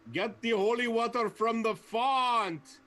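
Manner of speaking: yelling. Acted. Zarg yelling to Renard, slow and loud. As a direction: bellow it across the room, slowly. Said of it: "to yell as loud as I can".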